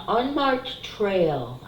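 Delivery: slow, drawn out "unmarked trail"; drawn-out "unmarked trail", read off the card. Shown as a demonstration. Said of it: "I'm sorry".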